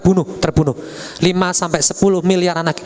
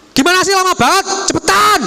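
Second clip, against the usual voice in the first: high, sharp rushing of a student; impatient scold; 12 semitones higher than usual. Acted. Impatient teacher scolding, acted. (guru tidak sabar membentak murid)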